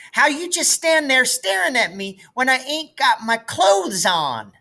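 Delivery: put-on tattle like a small girl; little sister's threat. Little sister threatens to tell Mama, high and childish. Maybelle complaining.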